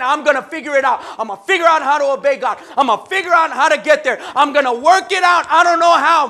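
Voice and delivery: loud, fast, resolute declaration; fast, loud I'm gonna do it; determined vow. Acted. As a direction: rattle it off full of resolve. Voicing a determined believer.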